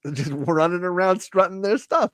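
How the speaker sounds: high, laughing mock bewilderment; bewildered Doug. Voicing Doug wondering if he is the prude, high and laughing. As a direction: play it flustered and scandalized.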